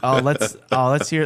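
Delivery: ordinary talk, nothing marked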